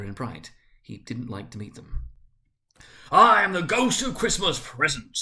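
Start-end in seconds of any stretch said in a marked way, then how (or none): 3.1-5.2 loud, high proclamation in a big voice; grand spirit. A narrator's reading. Spirit introducing itself to Scrooge, grandly loud. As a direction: announce yourself like a jolly giant.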